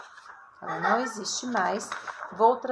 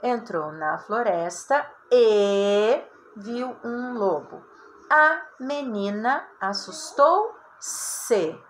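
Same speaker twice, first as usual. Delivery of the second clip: slow reading aloud; 4 dB louder, much slower than usual. Shown as a demonstration. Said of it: "teacher reads example text aloud".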